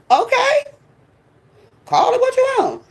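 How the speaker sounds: high dismissive okay, call it whatever; sarcastic high-pitched dismissal. Real, not acted.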